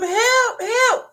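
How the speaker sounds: high, loud panicked calls; retold shrieks for help; mock cry for help. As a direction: call out in pretend desperation. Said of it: "talking about some".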